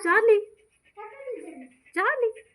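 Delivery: high sing-song call of a pet's name; calling the dog. Real, not acted. Owner calling her dog to look over, high sing-song call.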